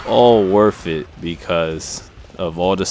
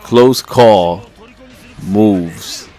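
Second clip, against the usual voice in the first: louder, clearly spoken after swallowing; clear correction; 2 semitones higher, 7 dB louder, much slower than usual. Real, not acted. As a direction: say it properly now, louder. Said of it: "trying to drink my damn juice".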